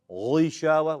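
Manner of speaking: overdone thick ghi in "Ghishawa"; super heavy ghi. Shown as a demonstration. Teacher mocks a wrong reading, over-heavy ghi in Ghishawa. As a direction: say Ghishawa with an exaggeratedly heavy ghain. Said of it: "we don't talk like that".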